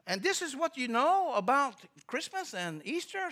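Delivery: put-on high, slow, loud foreign-accented question; broken English. Visitor checking what clerk knows, broken English accent. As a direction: imitate an incredulous Russian in broken English. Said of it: "he spoke real broken English".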